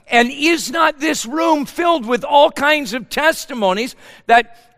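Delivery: loud, high preacher build; rally the room with testimony; preaching at full force. Acted.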